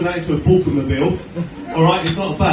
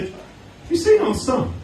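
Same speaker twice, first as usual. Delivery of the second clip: high, slow mock-innocent question; wife's question; 12 semitones higher, much slower than usual. Wife asking husband about their son, high and slow. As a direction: ask it slowly in a high worried wife voice. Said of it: "my wife turned to me and said".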